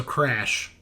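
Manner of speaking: normal talking voice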